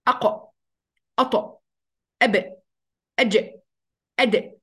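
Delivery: أق أط أب أج أد with the stopped consonant bouncing; echoing qalqala. Shown as a demonstration. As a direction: let each stopped letter bounce out. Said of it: "يبقى هي دي حروف القلقلة".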